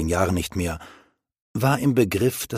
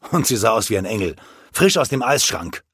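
ordinary delivery

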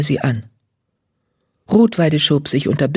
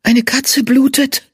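alarmed she-cat voice; 9 semitones higher, slower than usual. Acted. Female cat warrior smells blood.

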